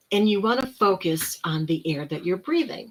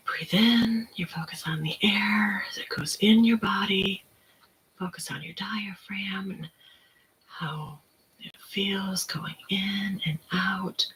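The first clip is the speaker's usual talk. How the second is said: slow, softer whispered guidance; guided breathing whisper; 4 dB quieter, slower than usual. Shown as a demonstration. Guiding viewers through breathing, in a slow whisper.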